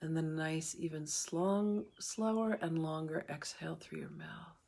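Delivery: calm meditation guide with long pauses between phrases; guided meditation. Real, not acted. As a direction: ease listeners through each breath, unhurried.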